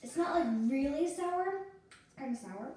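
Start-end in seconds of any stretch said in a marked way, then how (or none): 0.0-1.5 loud. Real, not acted. Sour candy, shouting.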